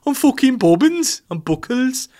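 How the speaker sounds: high, loud hammed-up Brummie; squeaky, over-the-top Brummie; mock Birmingham accent. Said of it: "an accent from".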